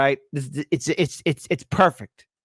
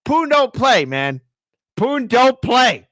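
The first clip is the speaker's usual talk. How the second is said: hammed-up warning; 11 semitones higher, 6 dB louder, slower than usual. Host warns Tucker about Putin, exaggerated.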